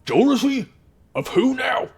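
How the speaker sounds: high, incredulous snaggletoothed goblin; middle-aged goblin. Acted. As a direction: question it through a nasty underbite. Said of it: "middle-aged goblin man with this".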